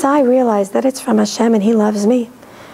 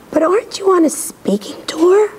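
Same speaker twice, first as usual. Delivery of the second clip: whispered quote; 6 semitones higher than usual. Retold or imitated. Retells caller's surprised question.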